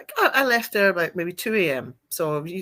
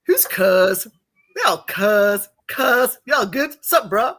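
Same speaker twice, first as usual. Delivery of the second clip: slangy cousin voice; 6 dB louder, much slower than usual. Retold or imitated. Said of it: "she'll be like".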